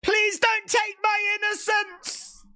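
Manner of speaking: high, slow hammed-up plea; mock pleading. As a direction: beg like a scandalised maiden.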